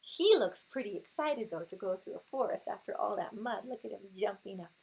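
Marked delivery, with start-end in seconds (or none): none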